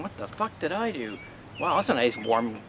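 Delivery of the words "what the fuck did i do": shocked. Retold or imitated. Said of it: "I'm just in total shock going".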